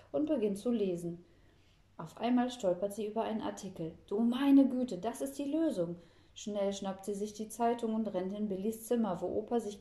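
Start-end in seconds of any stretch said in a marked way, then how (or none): 4.1-5.9 high, loud burst of excitement; excited discovery. A narrator's reading. Girl finds the answer in the newspaper, excited. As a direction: exclaim as the idea strikes.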